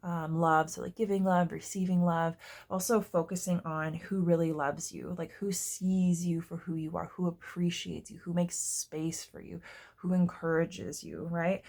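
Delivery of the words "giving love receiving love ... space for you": normal talking voice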